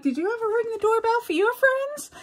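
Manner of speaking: put-on high, child-like questioning voice; kids asking. Kids questioning grandfather, high child voice. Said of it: "'cause the kids were like".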